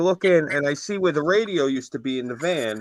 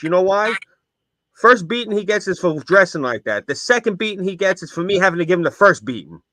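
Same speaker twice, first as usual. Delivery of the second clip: a stern uncle, louder and higher, laying down the law; stern uncle lecture; 6 semitones higher, 4 dB louder than usual. Retold or imitated. An uncle warning his nephew about punk clothes, in a stern raised lecture. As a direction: lecture it like a tough little old-school uncle. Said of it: "He's like".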